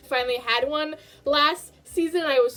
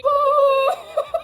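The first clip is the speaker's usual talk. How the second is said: burst of laughter; 10 semitones higher, 6 dB louder than usual. Real, not acted. Laughing at villain name.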